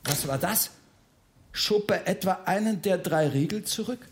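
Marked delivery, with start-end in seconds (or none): none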